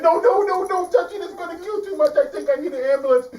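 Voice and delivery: very high, loud melodramatic panic; lion overreacting to the pain; hysterical lion. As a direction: wail for help in mock agony. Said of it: "The lion said".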